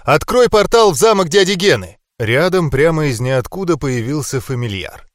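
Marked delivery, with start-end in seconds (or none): 0.0-2.0 urgent order. A narrator's reading.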